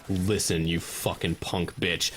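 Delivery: low, slow tough-guy growl; mock tough guy. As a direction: play the hard man threatening the medic. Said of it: "and you said".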